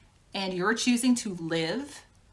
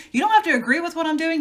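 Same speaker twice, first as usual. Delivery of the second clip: fast, higher and louder, hammering it home; emphatic insistence; 8 semitones higher, 7 dB louder, much faster than usual. Retold or imitated. To her disapproving mother, a fast loud insistence. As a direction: insist with rising heat.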